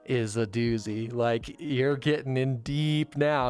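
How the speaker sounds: slow, drawn-out teasing warning; ominous warning. Retold or imitated. Warning coworker about the next episode, slow and teasing. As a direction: stretch it out like a spooky hint.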